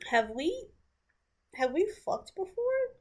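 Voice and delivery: nervously retold question, sliding up high. Rufus trying to de-escalate and set boundaries, imitated nervously. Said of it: "nervously setting his boundaries".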